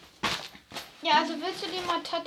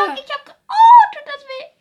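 real yelp of pain breaking into a laugh; pain scream; 12 semitones higher, 18 dB louder than usual. Real, not acted. Hurting himself on camera, a real pained shout. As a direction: scream out from the sudden sting. Sudden pain while getting waxed.

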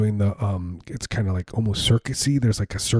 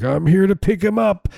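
higher, chirpy mock politeness; 9 semitones higher than usual. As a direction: say it brightly like a pickup. Voicing himself at old folks home.

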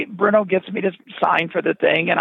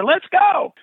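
eager let's go, higher; 12 semitones higher than usual. Retold or imitated. Eagerly accepts teaching job.

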